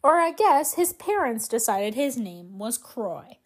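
normal talking voice

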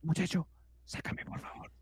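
hushed, breath-only begging; whispered plea. Retold or imitated. Unseen man begging the boy to free him, whispering. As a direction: beg under your breath, as if hiding. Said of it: "así me hizo".

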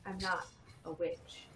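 soft, slow, measured statement; low-key and deliberate, softly spoken; quiet in-character denial. Acted. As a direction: say it slowly, well under your breath. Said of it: "just be like".

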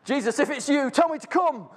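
high, bright plea called across the water; eager calling out. Acted. Peter calling to Jesus across the water, high eager plea. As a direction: call out to him, hoping and daring.

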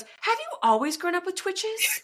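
high, put-on Kevin voice; imitating Kevin. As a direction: quote him in a puzzled, prying tone. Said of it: "and he goes".

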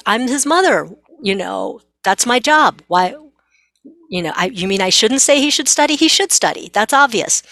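high, loud indignant mother; defensive mom. Acted. Mom defending her advice to moderator, indignant. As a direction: insist it's your job as his mother. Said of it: "I usually play the mom".

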